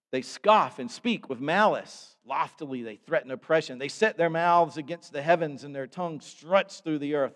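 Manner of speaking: loud, declaiming psalm reading from the pulpit; preached scripture reading. Acted. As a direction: proclaim the psalm to the congregation. Reads psalm aloud, preaching.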